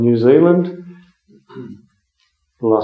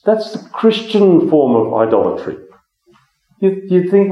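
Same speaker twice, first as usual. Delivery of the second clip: ordinary delivery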